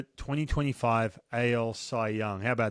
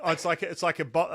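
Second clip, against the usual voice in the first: ordinary talk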